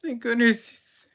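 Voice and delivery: high, loud gasp of relief; relieved researcher. Acted. Researcher in a lab coat greets the rescuers, high and loudly relieved. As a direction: sound rescued at last, almost weeping with relief.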